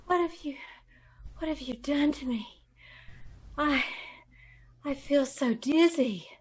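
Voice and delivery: soft, weak and drugged; faint, fading. Acted. Drugged secretary asking her captor faintly. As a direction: let the drug drag the words down.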